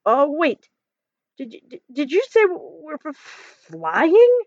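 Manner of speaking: high, stammering alarm; nervous stutter. A narrator's reading. Kermit dreading the flight, stuttering in fright. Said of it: "stuttered Kermit".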